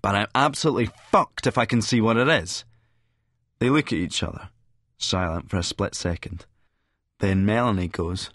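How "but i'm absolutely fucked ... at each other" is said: plain reading voice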